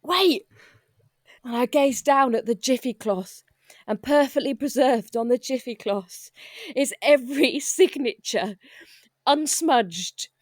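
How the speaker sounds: ordinary delivery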